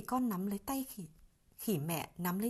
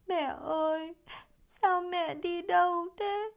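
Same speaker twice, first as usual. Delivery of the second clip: put-on sobbing child; 10 semitones higher, 4 dB louder, slower than usual. A narrator's reading. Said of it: "trong những tiếng nức nở".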